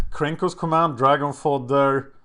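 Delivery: ordinary talk, nothing marked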